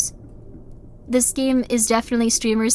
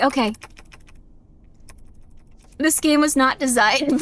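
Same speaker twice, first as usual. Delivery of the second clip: ordinary delivery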